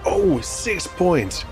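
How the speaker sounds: plain reading voice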